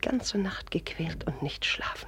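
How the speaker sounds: hushed, slow whisper to himself; whispered aside. Acted. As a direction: breathe it out under the breath, slowly. Father murmuring worried aside.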